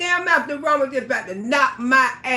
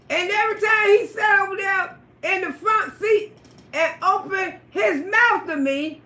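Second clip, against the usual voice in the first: usual speaking voice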